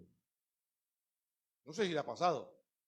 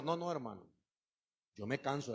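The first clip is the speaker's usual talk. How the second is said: usual speaking voice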